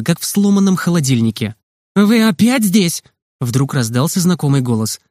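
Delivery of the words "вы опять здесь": higher, drawn-out scolding question; angry nurse. A narrator's reading. Nurse catches the boys behind her back, cross scolding. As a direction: scold them with mild exasperation. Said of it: "Теперь сердитая медсестра кричала".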